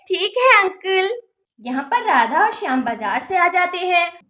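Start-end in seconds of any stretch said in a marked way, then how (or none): none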